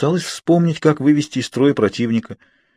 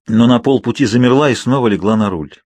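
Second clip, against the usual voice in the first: ordinary delivery, nothing marked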